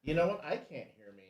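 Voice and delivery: distant, muffled mic; echoing bathroom. Real, not acted. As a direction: sounds far away in a tiled room. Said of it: "Sounds like you're in the bathroom".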